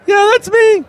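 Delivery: very high, loud squeak; high character voice. Acted. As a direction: answer proudly in a squeak. Floyd replies.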